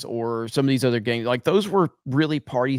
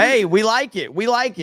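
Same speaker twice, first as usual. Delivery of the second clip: excited; 10 semitones higher, 4 dB louder than usual. Real, not acted. Joking enthusiasm.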